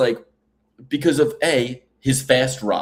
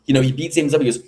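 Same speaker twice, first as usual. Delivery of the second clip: usual speaking voice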